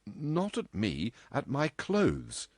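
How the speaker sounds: slow, measured correction; correcting. A narrator's reading. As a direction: set him straight word by word. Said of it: "she corrected".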